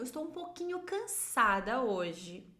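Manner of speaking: ordinary talk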